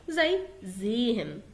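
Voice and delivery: زين said slowly with a voiced z; slow demo. Shown as a demonstration. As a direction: stretch زين to let the z buzz. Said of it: "انت سامع حرف الزين". (نطق زين ببطء بحرف زين)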